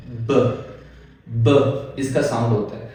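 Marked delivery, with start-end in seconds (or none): 0.1-0.6 ब said as a short bare b sound; letter sound b. Shown as a demonstration. Teacher showing students the sound of b. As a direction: say ब as the sound, not the letter name. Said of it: "बी, इसका sound क्या होता है".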